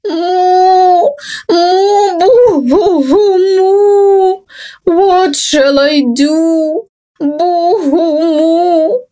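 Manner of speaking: loud wailing moos and sobs; crying cow. Acted. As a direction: wail like a lost, frightened cow. Lost cow crying in forest.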